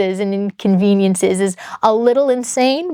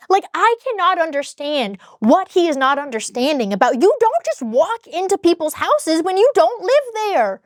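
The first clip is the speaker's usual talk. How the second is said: exasperated, rising higher in frustration; fired up; 7 semitones higher than usual. Real, not acted. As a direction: vent the disbelief, building up. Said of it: "so fired up again".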